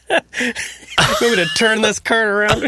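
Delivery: high-pitched, slow threat through laughter; mock parent threat. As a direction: threaten the squabbling kids, laughing.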